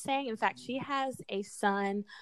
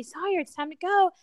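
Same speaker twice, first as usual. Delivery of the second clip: put-on calling child cheerfully; 8 semitones higher, 5 dB louder than usual. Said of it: "And then I say".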